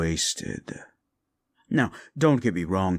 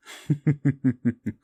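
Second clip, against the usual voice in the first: high, gleeful villain laugh; a delighted cackle of scheming; scheming demon's gleeful laugh; gloating laugh; 7 semitones higher than usual. Acted. Demon gloats over its plans.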